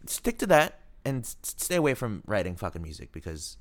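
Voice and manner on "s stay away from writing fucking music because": normal talking voice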